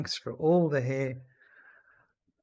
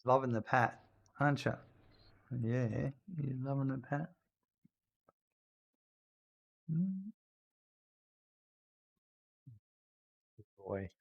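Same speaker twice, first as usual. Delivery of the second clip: soft pet talk; 6 dB quieter than usual. Real, not acted. Owner cooing softly to his old dog Randy, tender.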